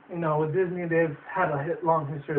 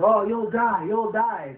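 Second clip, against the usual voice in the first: higher, louder eerie chant; spooky scarecrow warning; 5 semitones higher, 4 dB louder, much slower than usual. Retold or imitated. Scarecrows warning travelers, eerie raised voice. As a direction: warn them off like a haunted scarecrow. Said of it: "These scarecrows are like".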